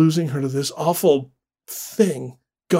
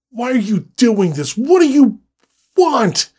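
high, loud desperate plea; 8 semitones higher, 6 dB louder than usual. Acted. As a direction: beg in panic. Paul pleads with the creature.

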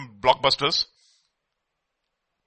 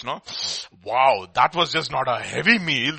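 usual speaking voice

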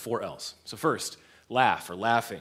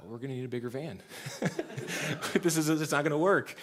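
laughing; 3 semitones higher than usual. Retold or imitated. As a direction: chuckle out the line in disbelief. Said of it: "I start to laugh. And then I say".

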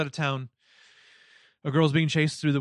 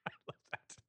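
whispered laugh; 18 dB quieter, faster than usual. Real, not acted. Laughing at shared quote.

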